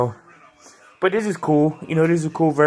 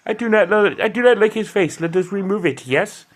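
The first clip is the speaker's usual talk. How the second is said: higher, hammed-up Turkish accent; a self-mocking, over-the-top Turkish accent; bad Turkish accent; 6 semitones higher than usual. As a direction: put on a clumsy Turkish accent for the villain's line. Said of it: "That's my Turkish accent. It is horrible".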